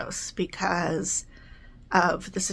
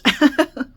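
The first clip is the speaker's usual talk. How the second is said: burst of laughter; 6 semitones higher, 7 dB louder than usual. Real, not acted. Laughs at own word search.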